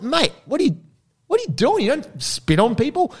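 high-pitched retold remonstration; indignant challenge. Bystander confronts man who spat on someone, indignant. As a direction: confront him, voice pitched right up.

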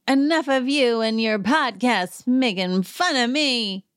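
a put-on Kyle confrontation voice; imitating Kyle. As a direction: sneer it out as a mock Kyle. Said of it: "Kyle goes up to Jeff Lewis and is like".

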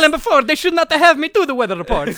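high, loud cartoon Italian accent; funny Italian voice. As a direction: protest in hammed-up Italian. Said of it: "for my funny voice".

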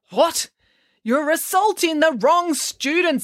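high, slow alarmed teacher; high panicky voice. Acted. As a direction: blurt it in alarm. Lang panicking.

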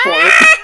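high-pitched shrieking outburst; piercingly high reaction yell; loud high shriek. Real, not acted. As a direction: let out a sudden loud squeal. Reacts to the bomb line.

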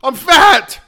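hammed-up surprise at the mirror; mock shock. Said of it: "it's like".

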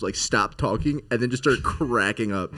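normal talking voice

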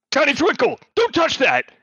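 high-pitched sharp warning; high scolding voice. Acted. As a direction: squeak out a quick scolding. Voicing character off camera.

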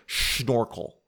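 "schnorkel" opened with a drawn-out SH; mock German pronunciation. Shown as a demonstration. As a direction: pronounce "schnorkel" German-style, stretching the first sound. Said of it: "That's the German way of saying".

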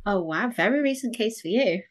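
usual speaking voice

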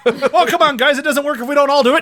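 high, loud comic pleading; mock exasperated shout. Co-host acting out playing dead, mock exasperated shout. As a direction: scold the group loudly, hammed-up.

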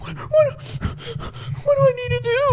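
high, scared and pleading; panicked kid voice. Retold or imitated. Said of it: "And I'm like".

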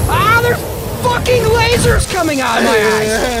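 high, loud frantic yell; screaming panic. Acted. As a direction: yell in alarm as your body goes haywire. Acted panic, lasers from eyes.

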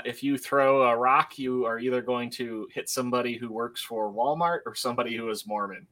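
normal talking voice

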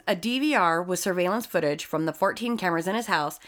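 ordinary talk, nothing marked

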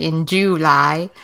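slow, stretched Southern July; drawn-out Southern accent on July. Real, not acted. Co-host slips into it while excited for July, Southern accent. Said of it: "I don't know why I said that's Southern".

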